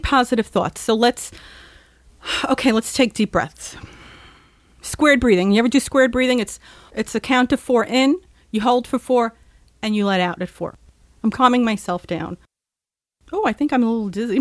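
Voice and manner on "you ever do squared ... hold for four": ordinary talk, nothing marked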